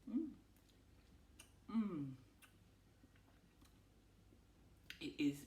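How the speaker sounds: talking through a mouthful; mouth full. Real, not acted. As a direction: speak around a bite of dessert. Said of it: "excuse me, eating with, talking with my mouth on".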